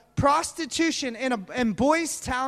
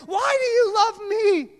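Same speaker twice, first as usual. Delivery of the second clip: higher, pleading cry; raised-pitch, impassioned demand; anguished plea; 7 semitones higher, 4 dB louder than usual. Acted. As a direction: cry it out as a desperate question. Acts out his own anguished question.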